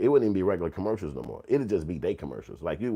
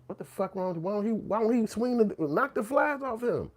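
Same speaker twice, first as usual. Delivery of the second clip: put-on baffled kid; 13 semitones higher than usual. Kid watching the charity ad, high and baffled. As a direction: rise up high in confused wonder.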